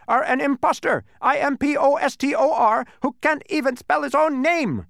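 very high, loud indignant tirade; very excited shout. A narrator's reading. Bee denounces the Humbug, excited shout. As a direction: shout and spell, worked up. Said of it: "shouted the bee very excitedly".

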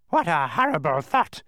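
high, loud, horrified squawk; horrified bird voice; shuddering bird. A narrator's reading. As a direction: recoil in a bird screech. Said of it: "the bird shuddered".

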